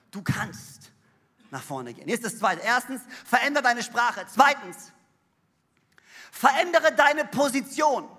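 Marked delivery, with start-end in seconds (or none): none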